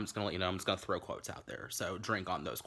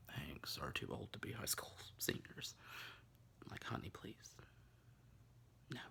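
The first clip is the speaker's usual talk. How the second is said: whisper; 3 semitones lower, 12 dB quieter than usual. Real, not acted. Scornful aside.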